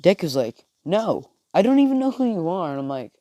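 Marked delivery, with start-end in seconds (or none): 0.9-2.7 high, protesting denial; bewildered, high-pitched no; indignant refusal. Acted. Acted Deku refusing a stranger.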